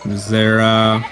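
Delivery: ordinary talk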